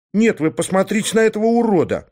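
high-pitched outraged appeal; indignant. A narrator's reading. Kotya appealing to onlookers about ungrateful friend, indignant. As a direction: protest with the pitch held high. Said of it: "возмутился Котя".

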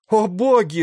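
very high, loud wail of horror; hysterical scream. A narrator's reading. Onlooker seeing men shot, wailing hysterically. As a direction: cry out in sheer panic. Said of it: "раздался чей-то истерический вопль".

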